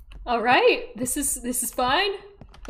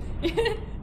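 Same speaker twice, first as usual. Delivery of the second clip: high shaky giggle; nervous laugh; 9 semitones higher, 4 dB quieter than usual. Real, not acted. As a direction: laugh from nerves. Nervous laugh in horror game.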